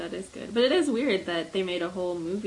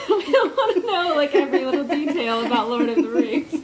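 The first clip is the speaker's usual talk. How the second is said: laughing through the words, louder and higher; 6 semitones higher, 8 dB louder than usual. Real, not acted. Cohost laughing as she tells fans to keep it brief. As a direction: giggle it out while making the point. Laughing while warning listeners.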